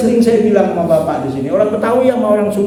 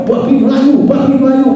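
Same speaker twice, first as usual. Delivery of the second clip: shouted louder, calling out; a loud call across the yard; shouting; 3 semitones higher, 4 dB louder than usual. Retold or imitated. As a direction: yell it as if chasing a pig. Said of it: "teriak-teriak dia".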